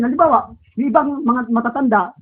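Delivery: ordinary talk, nothing marked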